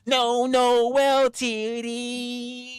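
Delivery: high, drawn-out mock voice; lightly voiced silly quote. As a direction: ham up the silly phrase. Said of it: "The panel verse said to me".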